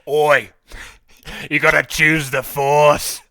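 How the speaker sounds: loud, slow Aussie Palpatine voice; Australian Palpatine. Pretend. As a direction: shout it like an Australian emperor. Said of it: "give me your best Australian Palpatine".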